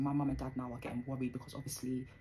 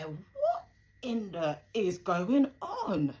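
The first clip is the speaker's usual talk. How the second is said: higher, much louder and slow, stunned disbelief; shocked outburst; 6 semitones higher, 11 dB louder, much slower than usual. Retold or imitated. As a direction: blurt it out as if you can't believe your eyes. Shock at dad's arrest.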